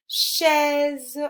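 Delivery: "Chaise" with a high, loud, held è; emphasised è sound. Shown as a demonstration. Teacher shows pupil the sound, è in "Chaise" emphasised. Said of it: "on entend bien le est".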